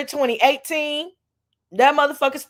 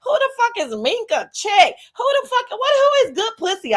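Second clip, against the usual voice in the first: high mock outrage; 11 semitones higher than usual. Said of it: "I'm like".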